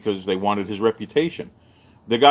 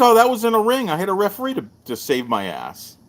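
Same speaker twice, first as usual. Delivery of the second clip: higher, playful excuse; mock-casual champion; joking Bill; 9 semitones higher than usual.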